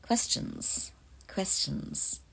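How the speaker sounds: breathy whisper prompt. Acted. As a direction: whisper it into the mic. Calling for questions, whispered.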